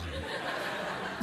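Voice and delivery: breathy burst of laughter. Real, not acted. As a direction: laugh out at the surprise. Host laughs at surprise.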